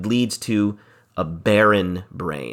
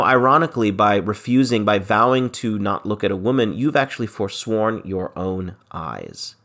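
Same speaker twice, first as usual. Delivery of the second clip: ordinary delivery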